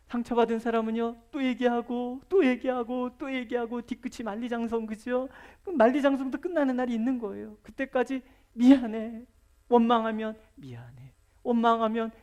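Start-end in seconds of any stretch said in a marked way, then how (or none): 0.1-8.2 loud, higher sermon delivery; preacher driving home the point about grudges; preaching at full force. Real, not acted. 8.5-9.2 louder, forceful sorry; preacher calling out a firm sorry. Acted. Sorry answered loudly. 10.5-11.1 softer, lower sorry; preacher murmuring a quiet sorry. Acted.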